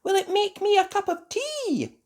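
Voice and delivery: very high, eager questions; excited fairy. A narrator's reading. Fairies asking Ben about the robot, excited high voices. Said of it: "clapping their hands excitedly".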